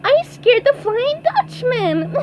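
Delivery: very high, gleeful squeal ending in laughter; excited SpongeBob. A narrator's reading. SpongeBob celebrates scaring the Flying Dutchman, high giggly glee. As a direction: crow it in cartoon triumph. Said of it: "cried SpongeBob".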